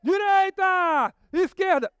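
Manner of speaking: high shouted directions; roller coaster shout. Pretend. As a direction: yell each turn like you're riding it. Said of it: "Vamos na montanha-russa".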